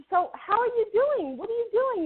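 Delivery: high-pitched, friendly catching up; retold eager greeting. Woman catching up with an old co-star, eager.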